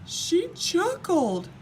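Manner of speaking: the king wails about her chuckling; wailing. A narrator's reading. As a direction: wail it out like a crying child. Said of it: "wailed the king".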